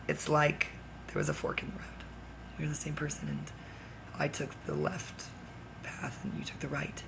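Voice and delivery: dropping to a soft, hushed voice. Real, not acted. Telling Kyle, softly and hushed. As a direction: hush it like a secret.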